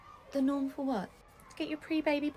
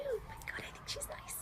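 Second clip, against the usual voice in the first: whispered aside; 11 semitones higher, 9 dB quieter than usual. Acted.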